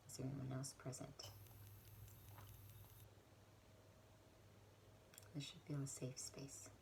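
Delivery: guided prayer. Real, not acted. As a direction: lead them gently and unhurriedly into prayer. Leads a guided prayer meditation.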